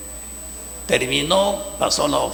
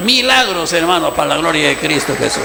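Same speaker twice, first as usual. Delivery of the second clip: exclaiming; 6 dB louder than usual. Real, not acted. Preacher exclaiming the miracles.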